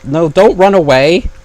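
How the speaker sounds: loud, drawn-out plea, pitched high; calling out. Real, not acted. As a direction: yell after someone running off. Calling after fleeing player for help.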